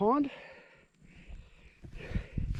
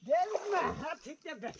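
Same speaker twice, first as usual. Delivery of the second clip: much higher, cartoonish, "I'll take that back" squeaked; high silly voice; 11 semitones higher, 4 dB quieter than usual.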